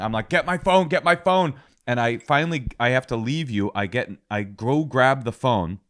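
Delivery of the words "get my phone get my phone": higher, mildly panicked mock yell; retold panic. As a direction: re-enact a touch of panic, pitched up. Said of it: "and I'm panicking".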